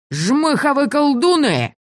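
high, slow bellowing roar; battle roar. A narrator's reading. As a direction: roar it out furiously. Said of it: "взревел другой пират".

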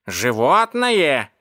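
slow, drawn-out indignant question. Acted. Cat echoes the insult to the onlookers, indignant. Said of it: "возмутился кот".

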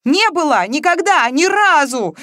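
high, loud, tearful protest; anguished outcry; sobbing denial. A narrator's reading. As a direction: deny it desperately, close to tears. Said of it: "уже откровенно всхлипывала Маша".

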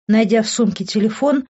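ordinary delivery

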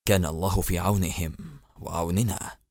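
low, soft weary sigh of a prayer. Acted. President sighing over his trapped soldiers, low and soft. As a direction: let the words sink with a sigh. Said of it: "تنهد الرئيس قائلا".

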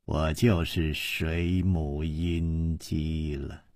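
low, slow and scornful; mock his claim with a cold laugh; the woman sneers that he cannot be Chu Liuxiang. A narrator's reading. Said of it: "这个女子就冷笑起来".

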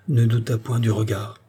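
usual speaking voice

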